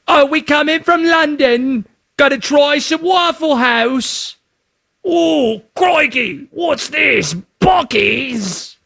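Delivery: awful mock British accent, high and excited; bad British accent. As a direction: play a London fan gawking at American chains in a bad British accent. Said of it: "My accents are awful".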